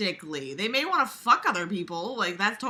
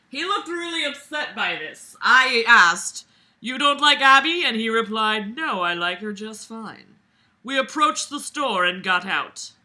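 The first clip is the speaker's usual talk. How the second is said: loud, raised read-aloud voice; story narration; 4 semitones higher, 8 dB louder than usual. A narrator's reading. Reader voicing two friends' talk aloud, projected narration. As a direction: act out the dialogue with big projection.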